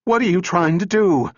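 high, loud harsh scream. A narrator's reading. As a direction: shriek the question at him. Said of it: "Her voice rose to a harsh scream".

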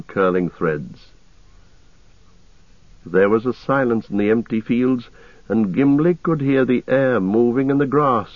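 plain reading voice

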